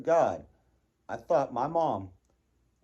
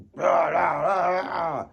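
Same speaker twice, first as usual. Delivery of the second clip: loud, higher mock roar of rah; mock angry growl; 7 semitones higher, 9 dB louder, much slower than usual. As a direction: bark rah like a tantrum. Mimicking angry outburst noise.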